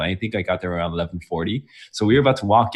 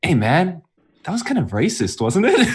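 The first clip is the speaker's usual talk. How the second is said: raised pitch, half-laughing mock complaint; teasing jab; 7 semitones higher than usual. Telling friends about the door lady, a playful higher jab. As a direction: poke fun at her, light and amused. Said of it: "And I was like".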